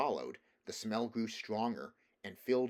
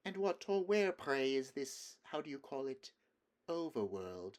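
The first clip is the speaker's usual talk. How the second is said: mocking witch; 5 semitones higher than usual. A narrator's reading. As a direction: toy with him.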